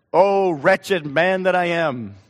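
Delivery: loud, slow, drawn-out lament; great unction. Acted. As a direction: cry it out slowly with preacherly fervor. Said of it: "And with great unction".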